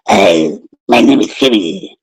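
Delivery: mocking a friend's bad Batman impression. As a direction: drop deep for a bad Batman. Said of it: "the worst Batman to Bruce Wayne".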